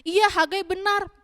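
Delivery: high, slow acted agreement; agreeing villager. One of the people answering Hagai, acted emphatic agreement. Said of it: "Terus ada juga yang bilang gini".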